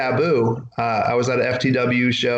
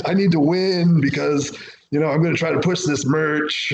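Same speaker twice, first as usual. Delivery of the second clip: mocking a cocky wrestler; 2 semitones higher than usual. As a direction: poke fun at self-important locker room guys.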